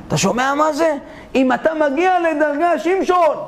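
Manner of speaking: normal talking voice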